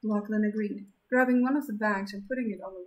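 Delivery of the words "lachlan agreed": ordinary delivery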